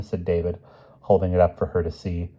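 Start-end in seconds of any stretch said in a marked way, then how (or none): none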